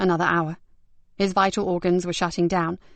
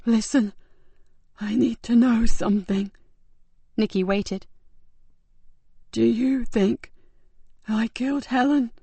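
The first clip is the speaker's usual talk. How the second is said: higher, slow, strained dying voice; weak dying man; 4 semitones higher, 3 dB louder than usual. Acted. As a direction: barely hold on to each word.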